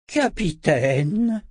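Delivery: slow drawn-out hiss, pitched high. A narrator's reading. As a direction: hiss the title with menace. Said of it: "siffla-t-il".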